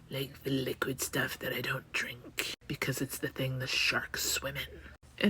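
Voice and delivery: hushed drawn-out aside; whispered aside. Real, not acted. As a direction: whisper the joke to yourself.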